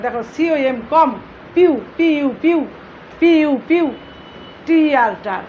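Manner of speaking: "কম্পিউটার" spelled and sounded out syllable by syllable; teacher's piece-by-piece word breakdown; broken into syllables. Shown as a demonstration. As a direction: break "কম", "পিউ", "টার" apart, each after its letters. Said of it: "ভেঙে ভেঙে উচ্চারণ করতে".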